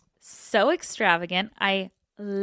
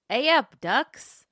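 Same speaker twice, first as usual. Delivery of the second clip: light Yorkshire greeting, bright and loud; a hint of broad Yorkshire; Yorkshire accent; 6 semitones higher, 5 dB louder, slower than usual. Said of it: "Strong Yorkshire accent required".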